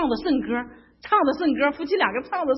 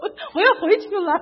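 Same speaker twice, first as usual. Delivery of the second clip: high, loud, laughing through it; laughing protest; 8 semitones higher, 5 dB louder than usual. Retold or imitated. Telling her friend she's going home, laughing protest. Retold whining phone call to friend.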